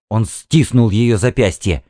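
usual speaking voice

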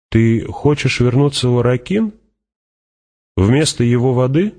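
high, rising question. Acted. Fremen questions Hawat on water bond, high and rising. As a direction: lift the pitch sharply towards the end.

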